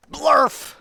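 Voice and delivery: sudden loud, high-pitched blurt; silly nonsense yell. Acted. Reader forced to say the book's nonsense word, silly high shout. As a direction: burst out with a silly noise.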